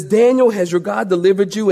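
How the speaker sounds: ordinary talk, nothing marked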